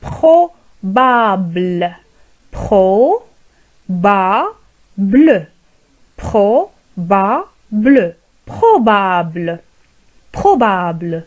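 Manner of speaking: probable said very slowly; very slow model of probable; slow model word. Shown as a demonstration. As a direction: stretch probable for learners. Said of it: "نقول".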